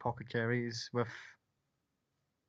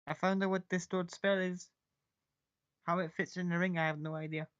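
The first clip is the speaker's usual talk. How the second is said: higher, self-satisfied character voice; pleased Maka voice; 6 semitones higher than usual. Acted. Maka telling the group about the spell, higher and smug. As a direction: sound smug about the discovery.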